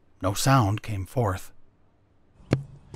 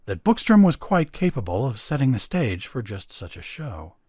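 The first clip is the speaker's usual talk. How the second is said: ordinary delivery, nothing marked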